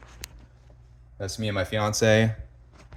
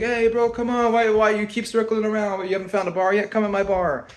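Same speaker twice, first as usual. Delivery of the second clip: very high mock tout voice; hammed-up imitation of a hustler, pitched way up; pushy tout; 13 semitones higher, 4 dB louder than usual. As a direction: pester in a high, put-on tout voice. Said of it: "he'll come walking up to me".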